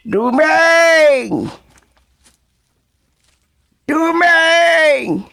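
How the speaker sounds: high, loud long call across the forest. Acted. Lost in the forest, calling for Dumeng, loud shout.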